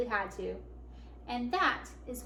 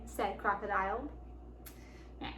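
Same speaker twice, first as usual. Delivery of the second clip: plain reading voice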